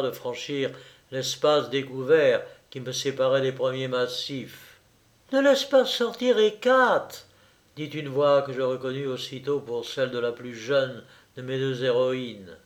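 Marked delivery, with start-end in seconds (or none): 5.3-7.3 put-on high-pitched girl's warning; girl calling out an order; urgent warning call. A narrator's reading. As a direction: call it out from the house, pitched well up. Calls out warning about the dog.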